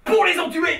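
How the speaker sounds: high, loud punchline; gleeful shout. Acted. Schemer revealing the con to his partner, gleeful shout. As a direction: belt out the payoff.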